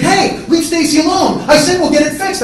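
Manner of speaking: loud, high-pitched defensive shouting; heated brother stepping in; angry confrontation. Acted. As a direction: shout him away from your girlfriend. Brother confronts him in acted argument.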